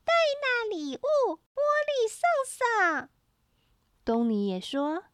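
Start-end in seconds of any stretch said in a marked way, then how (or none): 0.0-3.1 put-on squeaky slow toddler talk; very high baby-sister squeak; high, thin voice. A narrator's reading. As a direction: pipe up slowly in a tiny shrill voice. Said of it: "弄尖细的嗓子说".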